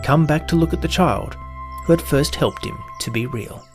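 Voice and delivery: plain reading voice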